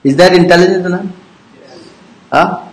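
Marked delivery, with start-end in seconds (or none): none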